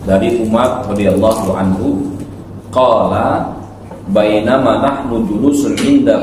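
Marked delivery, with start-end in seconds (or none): none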